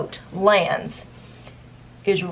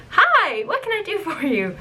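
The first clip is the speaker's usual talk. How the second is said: chirpy customer-service voice; 10 semitones higher than usual. Retold or imitated. Greeting customer at work.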